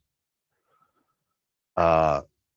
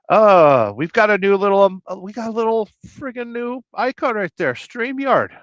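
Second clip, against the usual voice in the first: excited squeal; 11 semitones higher, 5 dB louder than usual. Acted. Excited about new icon.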